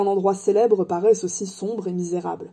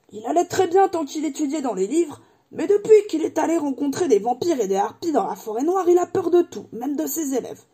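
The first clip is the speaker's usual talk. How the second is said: animated, higher-pitched character voice; good-hearted giant Hagrid, chatty; 6 semitones higher than usual. Acted. Hagrid telling Harry about the professor, animated chatter. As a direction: gossip fondly, then mumble about a lost item.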